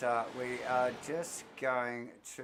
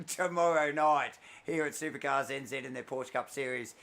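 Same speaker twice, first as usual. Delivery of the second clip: normal talking voice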